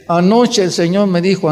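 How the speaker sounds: usual speaking voice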